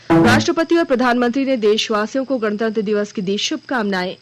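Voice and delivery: ordinary talk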